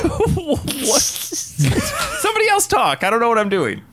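squeaky, very high, laughing too hard to talk; cracking up at your own blunder; high-pitched words squeezed out through laughter; helpless laughter. Real, not acted. Laughing at own failed impression.

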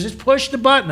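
high, pushed repeat of "Just push the button"; insisting. Retold or imitated.

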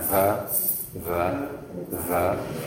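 V held as a soft buzz; buzzing v sound. Shown as a demonstration. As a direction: buzz the V quietly. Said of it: "Go ahead and do that".

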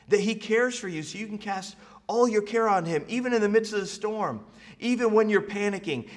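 high, loud preacher's build; preaching at full force. Acted. Preacher urging the congregation, full force. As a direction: lift it up and drive it home.

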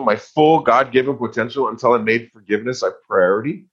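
normal talking voice

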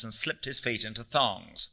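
ordinary delivery

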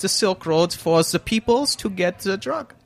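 slow mock German accent; put-on German accent. Guest explaining Silk Road's purpose, German accent. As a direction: spell it out slowly in fake German accent. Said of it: "talking in my own accent".

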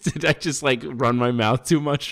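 high laughing disbelief. Real, not acted. Laughing to his friend about running his mouth. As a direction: laugh through the panic.

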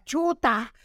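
high-pitched mock mom yell at the soccer game; mom yelling from the sidelines. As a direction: shriek it like an embarrassing mom cheering her son. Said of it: "Me decía".

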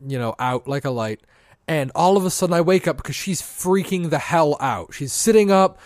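ordinary talk

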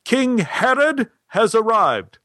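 high, slow proclamation; drawn-out, high-pitched call from the back. Retold or imitated. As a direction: announce it grandly across the theater. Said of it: "Stein called from the back of the theater".

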